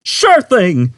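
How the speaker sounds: chattering computer. A narrator's reading. Computer answering Zaphod, chattering. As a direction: chatter eagerly. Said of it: "chattered the computer".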